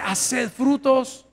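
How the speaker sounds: very slow, drawn-out words; solemn scripture. Real, not acted. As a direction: lay out each word with weight. Quoting scripture slowly.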